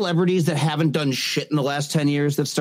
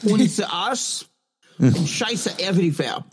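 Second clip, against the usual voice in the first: slow, higher hammed-up German accent; mock German accent; 4 semitones higher, much slower than usual. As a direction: ham up a fake German accent.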